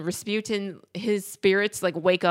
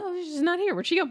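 put-on higher, lightly puzzled girl; 11 semitones higher than usual. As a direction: voice a confused young woman. Anastasia's puzzled quote.

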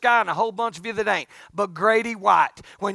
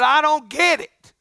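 1 exasperated outburst; 7 semitones higher, 8 dB louder, slower than usual. Retold or imitated. Retold complaint to God.

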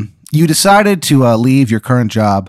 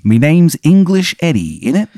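hammy fake Cockney accent, a bit higher; terrible English accent; 5 semitones higher than usual. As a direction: ham up a bad London accent. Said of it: "it's a terrible accent".

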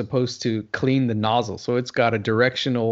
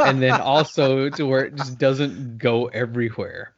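normal talking voice

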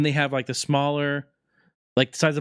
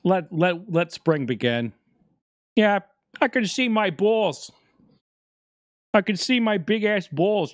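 groundhog voice; 6 semitones higher, slower than usual. Retold or imitated. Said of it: "he's like".

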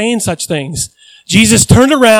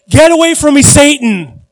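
louder, higher furious rebuke; angry rebuke; 5 semitones higher, 6 dB louder than usual. Retold or imitated. Jesus turning to rebuke Peter, loud furious shout. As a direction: rebuke Peter, beet red with anger. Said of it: "he said".

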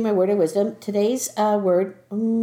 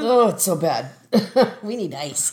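after a shot of liquor; 3 dB louder than usual. Real, not acted. Second reaction to tequila.

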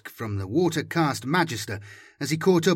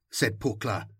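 ordinary delivery

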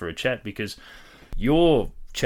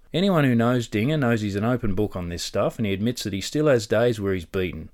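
louder, upbeat host intro; podcast intro read; 6 dB louder than usual. Acted. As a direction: sell the episode like a presenter. Reads the episode intro.

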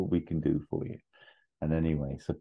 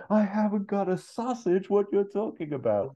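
very high, strained crying voice; angry tearful Ferrell. Retold or imitated. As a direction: tear up with feral anger. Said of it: "He goes".

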